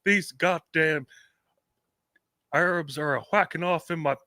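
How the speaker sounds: a hammed-up cartoon Texan; Hank Hill voice.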